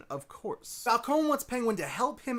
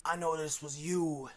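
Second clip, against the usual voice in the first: put-on Italian mobster accent; 2 semitones lower, 3 dB quieter than usual. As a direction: imitate Falcone as a soft-spoken Italian don. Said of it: "He's not that Italian".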